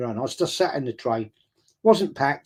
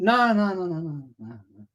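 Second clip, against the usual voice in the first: fading hasty denial; 4 semitones higher than usual. Retold or imitated. Hastily denying going to game.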